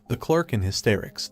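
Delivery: plain reading voice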